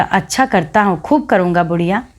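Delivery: plain reading voice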